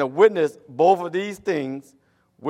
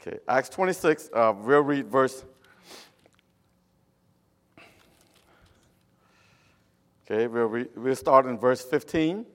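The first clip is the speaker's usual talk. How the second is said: Cambodian-accented English; 2 semitones lower than usual. Real, not acted. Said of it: "you can say Cambodian English".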